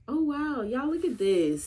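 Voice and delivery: high delighted wow; surprised. Real, not acted. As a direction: gasp at something lovely. Sees a blue jay.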